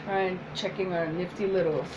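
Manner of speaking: ordinary talk, nothing marked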